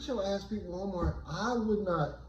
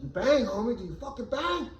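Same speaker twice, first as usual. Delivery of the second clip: gangbanger pressing; 7 semitones higher than usual. Retold or imitated.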